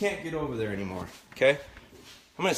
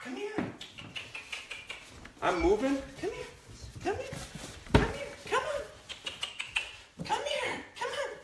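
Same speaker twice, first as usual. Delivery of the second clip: much higher, sing-song come here; high puppy call; 6 dB quieter, slower than usual. Real, not acted. Trainer coaxing the puppy over, high sing-song call. Calling the puppy while moving.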